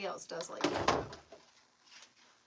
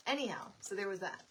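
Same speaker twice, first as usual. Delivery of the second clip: normal talking voice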